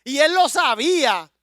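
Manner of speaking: rising into a loud, high preaching climax; growing into impassioned preaching. Real, not acted. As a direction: build the sermon to full force. Preaching at full force, building.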